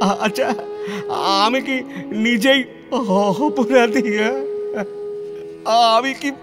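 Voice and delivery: high, broken sobbing with halting questions; weeping. Acted. Lonely reformer asks if he is heartless, sobbing. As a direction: break down, crying through the words.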